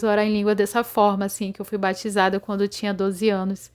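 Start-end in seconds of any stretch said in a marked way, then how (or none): none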